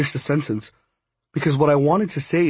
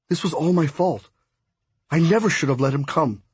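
usual speaking voice

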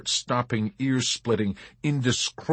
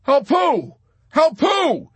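high, loud, urgent shouted question; calling back; 8 dB louder, slower than usual. A narrator's reading. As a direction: yell back frantically over the intercom. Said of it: "Yossarian called back".

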